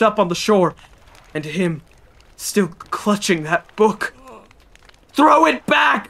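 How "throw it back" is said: high, loud desperate cry. Acted. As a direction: yell it at the shore. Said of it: "we cried".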